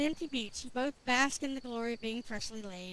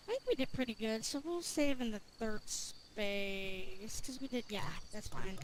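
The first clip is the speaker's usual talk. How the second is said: muttering to self; 5 semitones lower, 9 dB quieter than usual. Real, not acted. Muttering while saving.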